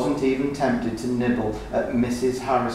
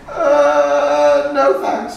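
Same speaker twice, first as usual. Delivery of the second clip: much higher, loud frightened yell; 8 dB louder, much slower than usual. Acted. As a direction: yell it out in panic. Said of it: "he yelled".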